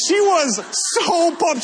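excited. Real, not acted. Excited retelling of camp story.

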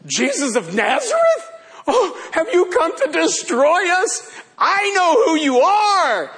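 hammed-up demon outcry; demon crying out. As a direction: wail it like a demon caught out. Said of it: "He cried out".

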